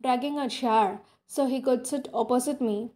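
ordinary delivery, nothing marked

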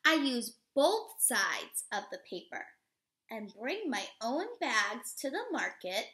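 slow, expressive storybook read-aloud; reading to children. Acted. Teacher reading a picture book to children, expressive read-aloud. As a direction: read it warmly to a class of little kids.

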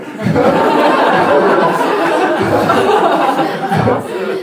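long, high, breathy laughter; airy laugh. Real, not acted. Preacher laughing after the hangover joke, long airy laugh. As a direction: laugh at your own punchline.

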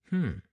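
short, high muttered "hmm"; interested hum. A narrator's reading. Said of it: "in an interested way".

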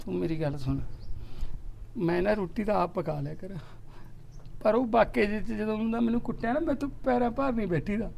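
choked-up moaning. Acted. Elder brother whining to younger brother, near tears. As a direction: mope about the beatings, near tears. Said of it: "ਉਹ ਬਾਈ ਤੂੰ ਰੋਣਾ ਯਾਰ".

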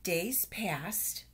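usual speaking voice

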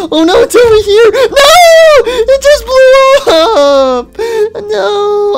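very high, loud despairing cry; anguished shriek. Acted. Owner seeing his car blow up, shrieking in despair. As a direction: scream as your dream car explodes.

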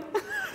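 high, soft awkward giggle; loser giggle. Retold or imitated. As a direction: imitate your own dorky little laugh. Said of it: "like a loser, I'm like".

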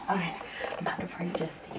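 fast, soft whisper. Real, not acted. Girl whispering to Justin while someone prays. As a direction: hush it so as not to disturb.

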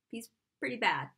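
normal talking voice

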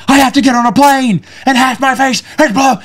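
high, exasperated mock tirade; furious outburst. Furious speaker who must fly with blue face, imitated outburst. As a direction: act out a man angrily blaming his friend. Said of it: "he is furious".

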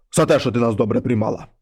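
low, heavy voice of a wolf head at the door; low monster head voice. Acted. Wolf head knocks and calls the girl, low and heavy. Mare head gives reward.

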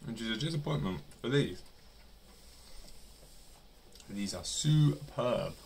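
words through mouthful of food; speaking between bites; talking while chewing. Real, not acted. Seen 2: Bites nugget at -5; jaw and lips keep working into speech.

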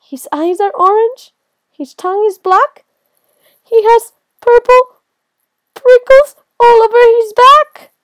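high, loud, mounting fright; panicked recognition. Acted. Mouse recognizes the monster it invented, high panic.

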